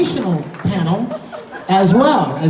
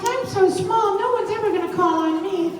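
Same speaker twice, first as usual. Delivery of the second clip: high, small shy little voice; high mousy voice; mocking timid girl; 12 semitones higher, 4 dB quieter than usual. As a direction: squeak it out like a child who hides. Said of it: "She's like".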